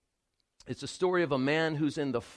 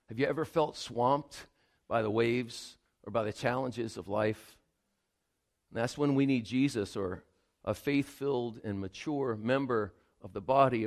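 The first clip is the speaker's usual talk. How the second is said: ordinary talk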